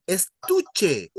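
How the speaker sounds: slow "estuche", with a stretched "tu"; stress on TU. Shown as a demonstration. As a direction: lean on "tu" in "estuche". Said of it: "you stress the second last syllable".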